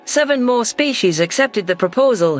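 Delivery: ordinary delivery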